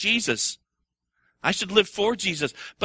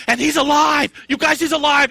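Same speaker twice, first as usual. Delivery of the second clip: much higher, louder joyful shout; women at the tomb shouting for joy; excited shout; 10 semitones higher, 8 dB louder than usual. Retold or imitated. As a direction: shout the news breathlessly. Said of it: "off they go running, proclaiming".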